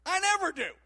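loud, high-pitched quoted retort; emphatic denial. Retold or imitated. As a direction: quote him insisting, voice jumping up high. Quoting John's emphatic denial.

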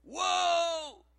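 high, drawn-out amazement. Retold or imitated. Speaker amazed by friend's insight, wide-open amazement. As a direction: raise it into a big, high exclamation. His amazed reaction, bigger take.

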